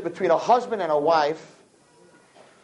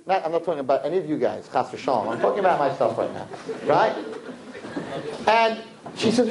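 usual speaking voice

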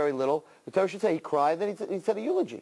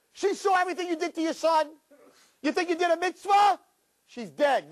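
high taunting accuser; 11 semitones higher than usual. Acted. Satan jeering that Sarah died, high and shouting. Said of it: "What do you mean?".

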